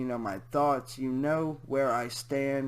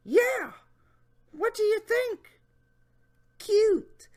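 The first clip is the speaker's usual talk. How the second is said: high, louder creepy question; high mock leer; Jeff Stacy voice; 6 dB louder, slower than usual. Reading the predator's question, high mocking voice. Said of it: "my Jeff Stacy voice".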